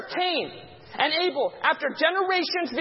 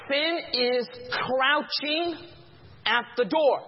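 drawn out, each word weighed; preacher savoring the verse; slow scripture reading; much slower than usual. Shown as a demonstration. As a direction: read it slowly, like a solemn warning. Preacher slowly reads scripture.

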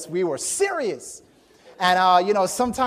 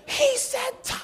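very high, slow hammed-up shout of disbelief; astonished outburst; 9 dB louder, slower than usual. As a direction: cry out as if it's shocking news. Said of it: "And we'd be like".

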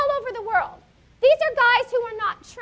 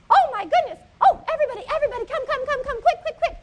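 excited crowd calling; 4 semitones higher, faster than usual. Acted. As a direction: rush everyone over, thrilled. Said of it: "everybody's like".

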